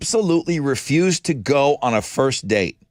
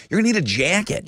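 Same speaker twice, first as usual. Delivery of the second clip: put-on jacket line in a Yankee accent; hammed-up New England accent; 4 semitones higher, faster than usual. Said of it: "in my best Yankee accent".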